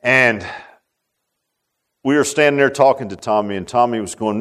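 ordinary talk

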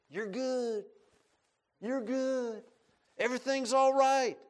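high, reassuring tempter voice rising; a lightly voiced devil soothing; Satan's false comfort. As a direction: sweet-talk them into staying put. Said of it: "Satan tells people".

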